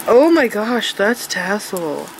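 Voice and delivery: usual speaking voice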